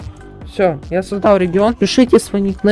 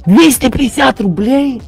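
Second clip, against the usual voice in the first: surprised; 6 semitones higher, 7 dB louder than usual. Real, not acted. Reacts to a donation.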